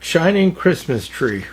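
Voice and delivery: plain reading voice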